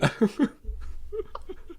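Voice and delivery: breathless high giggle; real wheezy laughter; high wheezing laugh. Real, not acted. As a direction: laugh till it squeaks. Laughing about the nuclear beer.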